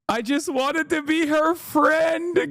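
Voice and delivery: overplayed tearful whine, drawn out slow; hammed-up crying plea. Mocking a tearful aide to her podcast listeners, hammed-up sobbing whine. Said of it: "[name] added".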